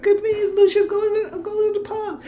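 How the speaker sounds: high falsetto begging; Esperanza pleading. Retold or imitated. Esperanza begging Lucian to go to the pub, high pleading falsetto. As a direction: plead in a high put-on woman's voice.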